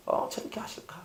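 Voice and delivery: near-whispered wondering; hushed inner voice; acted humble reflection. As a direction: wonder to yourself, barely voiced. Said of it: "이렇게 하면 이건 종의 자세".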